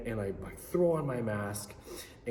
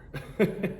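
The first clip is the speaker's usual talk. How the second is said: short laugh; 8 semitones higher, 4 dB louder than usual. Real, not acted. Laughing at the memory.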